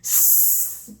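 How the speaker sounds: স as a long voiceless hiss with no voice; hissed s sound. Shown as a demonstration. Phonics teacher demonstrating স to children as a long hiss. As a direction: push air out through the teeth for স. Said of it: "একটি হচ্ছে".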